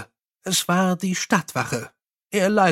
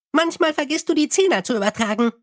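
high, chirpy little imp; 10 semitones higher, faster than usual. Acted. Imp soothes the angry Mumm, high and chirpy. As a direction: pipe it up high like a tiny helpful imp. Imp explains Mumm's errors.

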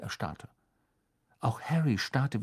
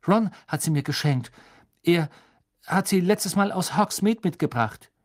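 put-on higher, louder young voice; a boy hastily explaining; nervous boy; 7 semitones higher, 5 dB louder than usual. Harry nervously explaining the joke shop bag to Snape.

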